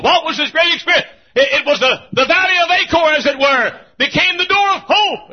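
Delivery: high, loud, ringing pulpit proclamation; preaching at full force. Acted. Preacher to his congregation, proclaiming at full force. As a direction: build each line to a shouted peak.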